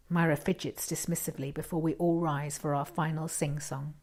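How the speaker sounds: ordinary delivery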